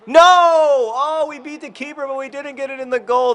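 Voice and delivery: high, loud despairing cry; anguished shout. Real, not acted. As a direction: wail as the shot misses. Keeper beaten, shot missed.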